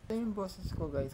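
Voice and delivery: faint, distant, unmiked voice; quiet voice with no microphone. Shown as a demonstration. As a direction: talk without a mic, thin and far. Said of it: "kapag walang microphone".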